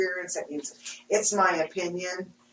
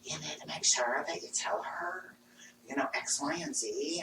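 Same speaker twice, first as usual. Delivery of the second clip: whispered spirit message; 3 semitones lower, 10 dB quieter than usual. Retold or imitated. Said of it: "there they are going".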